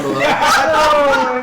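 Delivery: high, loud, stretched out by laughter; laughing shout. Real, not acted. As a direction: shout it out while cracking up. Player cracks up mid-joke.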